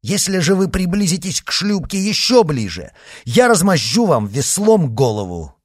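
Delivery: loud, high menacing threat; threatening shout. A narrator's reading. D'Artagnan threatening Mordaunt from the boat, loud and high. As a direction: threaten him with the oar, full voice.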